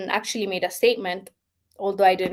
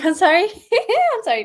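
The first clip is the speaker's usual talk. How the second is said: laughing apology; 11 semitones higher, 5 dB louder than usual. Real, not acted. Laughs off emotion.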